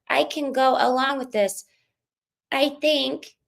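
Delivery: lightly voiced prim reality star; 1 imitating Whitney. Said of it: "Whitney goes".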